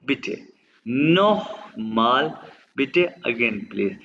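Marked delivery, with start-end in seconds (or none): 0.9-2.3 नोखमाल drawn out slowly with a throaty ख; slow नोखमाल with a rasped ख; guttural kh. Shown as a demonstration. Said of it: "आपने खे की आवाज़ निकालना है".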